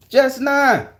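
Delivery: high-pitched burst of disbelief; incredulous exclamation. Real, not acted. Telling viewers about the squirrel's challenge, incredulous exclamation. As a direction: cry it out in amazement.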